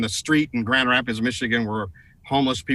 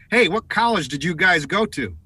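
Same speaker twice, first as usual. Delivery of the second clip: mock call from across the street, slightly raised; higher-pitched heckling call, only half shouted; retold yell; 6 semitones higher, 3 dB louder than usual. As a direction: mildly imitate a man hollering, pitched higher. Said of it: "he's yelling something at us".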